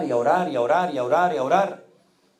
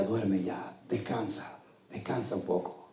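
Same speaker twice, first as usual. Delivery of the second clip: much softer, tender hush; soothing wife; 3 semitones lower, 10 dB quieter, slower than usual. Retold or imitated. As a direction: hush him gently to sleep. Wife comforts him at night. (la esposa le susurra con ternura que descanse)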